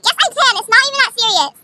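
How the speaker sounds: high, loud mock protest; hammed-up argumentative whine; defiant retort. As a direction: imitate a girl protesting shrilly. Girl's acted argument back.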